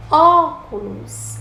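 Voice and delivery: "óculos" high and loud, long first Ó; "óculos" called slowly, leaning on the first syllable; stretched stressed syllable. Shown as a demonstration. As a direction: hit and hold the Ó of "óculos". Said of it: "a primeira sílaba é a antepenúltima".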